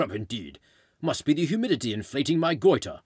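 hammed-up British old-chap voice; put-on British officer accent; mock British accent. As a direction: bluster like a British colonial gent. Continuing the British officer act.

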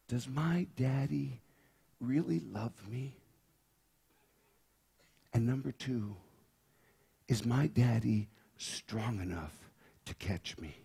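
a whispered fear in the heart; hushed inner question. Acted. Preacher voicing the girl's silent doubt to the congregation.

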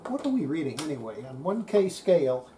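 ordinary talk, nothing marked

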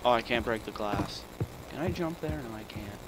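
normal talking voice

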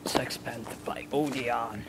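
slow whispered sultry ad voice; perfume ad. Pretend. Friend parodying a perfume advert, slow whisper. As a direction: breathe it like a perfume commercial.

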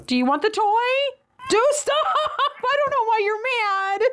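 begging the doll to stop through real laughs; laughing exasperation. Real, not acted. Overwhelmed mom laughing at the inconsolable doll, high and desperate.

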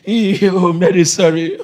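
hammed-up tearful sympathy; mock weeping. Preacher acting Jesus weeping with Mary, mock weeping. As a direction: pretend to wail along with the mourner. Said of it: "And Jesus wept".

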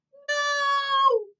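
a sudden high, loud "No!"; loud cry. A narrator's reading. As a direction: refuse at full volume. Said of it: "Melinda almost deafened the doctor".

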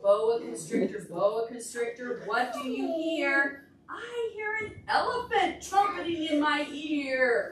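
singsong refrain, a bit slow; storybook chant; reading to children. Acted. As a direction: chant the book's refrain for toddlers. Reads picture book to children.

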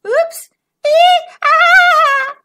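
high, loud little squeals; squeals of alarm. Acted. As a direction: squeak like a tumbling bug. Ladybug crashing.

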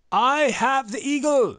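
high, drawn-out shout; triumphant cry. Acted. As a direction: yell it to the heavens in triumph.

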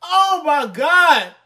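high, loud, stunned exclamation; loud disbelief. Real, not acted. Man learning his cousins really say it so, loud amazement. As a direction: cry out in amazement.

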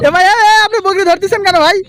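high, loud shouted question; urgent calling out. Real, not acted. Reporter challenging a farmer grabbing a goat, urgent shout. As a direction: holler to challenge him from a distance.

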